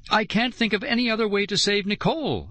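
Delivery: high desperate cry; frantic high outburst. A narrator's reading. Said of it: "I cried".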